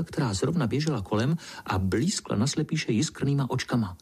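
ordinary delivery

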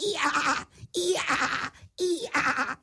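high comic hee-haws; donkey braying. A narrator's reading. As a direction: bray like a little donkey. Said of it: "vyprávěli si v oslovštině". (vysoké oslí hýkání)

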